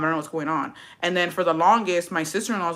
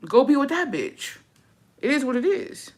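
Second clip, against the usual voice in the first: sassy dismissal; 8 semitones higher than usual. Real, not acted.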